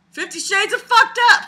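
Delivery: high, loud title cry; hammed-up shout of the title; mock announcement. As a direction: proclaim it like a movie tagline. Mocking the book's line.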